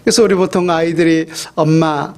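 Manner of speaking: ordinary delivery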